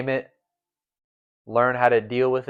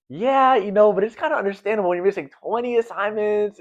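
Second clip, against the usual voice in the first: teachers; 10 semitones higher than usual. Retold or imitated. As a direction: put on a sing-song teacher tone. Teachers voiced in retold story.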